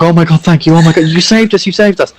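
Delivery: rescued villagers pouring out thanks; grateful crowd. Acted. Rescued townsfolk thanking heroes.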